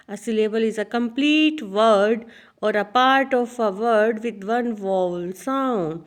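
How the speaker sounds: very slow, deliberate dictation; reading a definition. Shown as a demonstration. Teacher dictating the syllable definition to students, slow and deliberate. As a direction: read it out slowly so students can copy.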